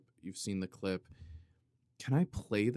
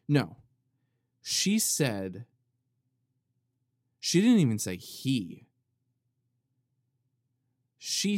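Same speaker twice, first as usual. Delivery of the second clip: louder, slowed "She didn't say" each time; insistent, louder storyteller emphasis; emphatic listing; 5 dB louder, slower than usual. Real, not acted. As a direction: build the case point by point. Emphatic build on the quote.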